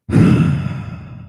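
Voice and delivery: long exasperated exhale; real heavy sigh. Real, not acted. Driver worn out by the night, real heavy sigh. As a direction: let out a long, weary sigh.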